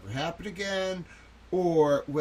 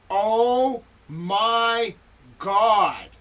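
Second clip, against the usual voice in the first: horrified shout; 5 semitones higher, 6 dB louder, much slower than usual. Acted. Horrified outburst at video.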